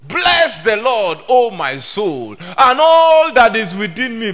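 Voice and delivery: loud, slow, ringing pulpit proclamation; a preacher booming scripture from the pulpit; full-force preaching. Acted. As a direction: thunder the psalm out to the congregation.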